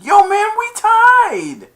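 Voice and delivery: very high, loud, drawn-out cheer; imitated jubilant shout. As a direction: yell the news like a thrilled player. Players celebrate tie.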